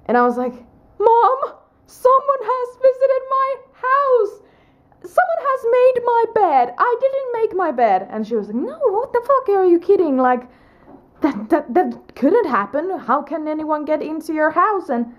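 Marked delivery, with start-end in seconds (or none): 1.0-8.1 high, urgent alarm; frantic pitch jumps way up; panicked. Retold or imitated. Panicked telling mom about intruder. 8.6-14.9 high, incredulous mom voice; lightly voiced shocked mother; imitating mom, disbelieving. Mom's disbelieving reply.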